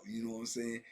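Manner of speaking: usual speaking voice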